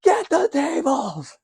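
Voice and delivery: hammed-up Dudley yell; tables shout. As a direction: holler it like a ringside catchphrase. Quoting Dudley tables call.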